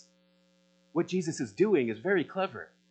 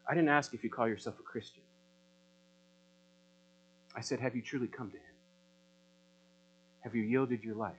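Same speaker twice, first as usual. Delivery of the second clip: hushed, searching appeal; 3 semitones lower, 7 dB quieter than usual. Acted. Pastor quietly confronting each listener about true faith, hushed. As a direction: lower and softer, close and probing. Searching personal challenge after music.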